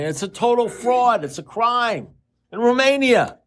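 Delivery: high-pitched, worked-up imitation of a caller; retold indignation, climbing high on key words; indignant outcry. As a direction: pitch it way up in mock outrage. Acting out friend's indignant report.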